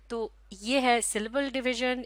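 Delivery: usual speaking voice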